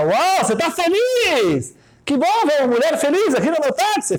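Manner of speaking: high, slow, beaming cabbie; taxi driver voice. Retold or imitated. Taxi driver cheerfully greeting his bride passenger, high voice.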